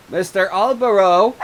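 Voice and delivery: high, loud call through a door; woman outside calling his name. Acted. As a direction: call him from outside the house.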